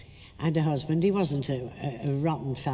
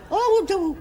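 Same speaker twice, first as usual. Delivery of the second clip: high startled cry; mock shriek from the gallery; 7 dB louder than usual. Audience member caught by surprise, high mock cry. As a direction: shriek it from the upper circle. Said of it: "I suddenly heard".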